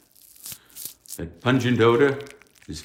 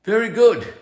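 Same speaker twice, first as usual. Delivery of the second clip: pleased praise; 7 dB louder than usual. Acted. Professor praises, pitch jumps.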